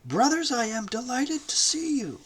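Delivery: high, delighted welcome; joyful greeting. A narrator's reading. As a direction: greet them with open joy. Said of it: "one joyful and triumphant word".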